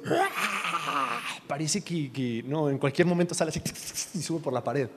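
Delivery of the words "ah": long mock crying scream; imitated baby wail. Father imitating his three-month-old crying, mock wail. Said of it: "él está así llorando".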